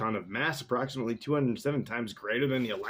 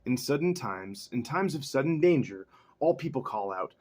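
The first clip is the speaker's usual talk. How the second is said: ordinary talk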